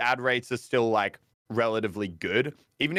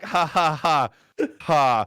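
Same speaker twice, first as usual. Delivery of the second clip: mock laugh getting louder, drawn out; slow fake laugh; 5 semitones higher, 5 dB louder, slower than usual. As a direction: ham up a forced laugh. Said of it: "was like".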